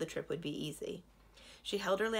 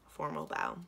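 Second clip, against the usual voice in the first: ordinary delivery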